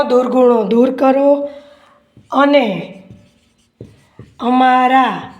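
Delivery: word by word with long pauses; slow dictation. Acted. Teacher dictating an answer to students, slow dictation. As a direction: dictate slowly, leaving time to write.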